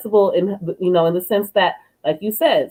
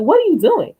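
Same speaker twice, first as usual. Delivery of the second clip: put-on puzzled kid; 8 semitones higher than usual. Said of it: "She's like".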